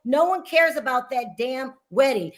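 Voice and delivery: usual speaking voice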